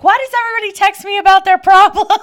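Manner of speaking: high, loud mock exasperated whine; exasperated friend. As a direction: mimic a frazzled friend complaining. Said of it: "And she was like".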